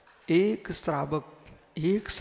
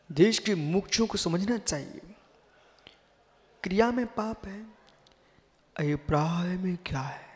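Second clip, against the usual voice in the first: normal talking voice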